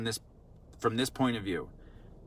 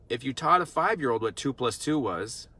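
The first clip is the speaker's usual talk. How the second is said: ordinary talk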